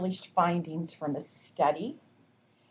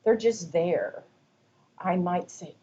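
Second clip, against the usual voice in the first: normal talking voice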